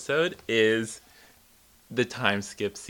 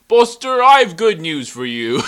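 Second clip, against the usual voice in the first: cheerful principal; 7 semitones higher, 14 dB louder than usual. Retold or imitated. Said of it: "It's like".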